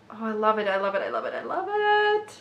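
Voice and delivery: giddy, rising to a squeal; excited gushing. Real, not acted. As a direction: start normal, climb into a delighted squeal. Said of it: "I'm so excited".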